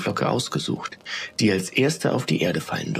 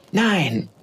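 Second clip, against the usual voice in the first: high-pitched shouted refusal; defiant cry; 8 semitones higher than usual. A narrator's reading. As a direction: cry out a stubborn refusal, falling at the end. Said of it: "schrie Funkelchen".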